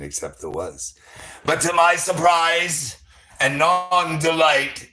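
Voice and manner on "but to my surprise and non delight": slow, higher-pitched mock-grand storytelling; dramatic build-up. Teasing the fortune cookie reveal to listeners, slow and dramatic. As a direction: stretch it out to build suspense.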